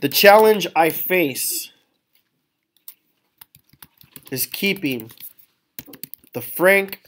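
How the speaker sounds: slow, measured dictation of a model text; slow dictation while typing. Shown as a demonstration. Teacher typing a sample monologue for the class, slow dictation. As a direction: read out slowly, word by word, as you type.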